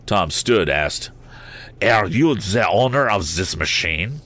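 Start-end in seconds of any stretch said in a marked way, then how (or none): 1.8-4.2 put-on fussy little Frenchman, slow, heavy French accent. A narrator's reading. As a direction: ask it slowly with Z's for th, French-style. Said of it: "a fussy little Frenchman".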